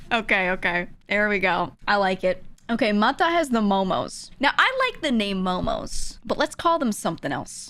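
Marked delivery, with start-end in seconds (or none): none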